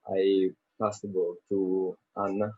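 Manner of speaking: usual speaking voice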